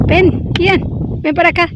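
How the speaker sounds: loud call across the yard; calling from far away. Real, not acted. Calling a child over outdoors.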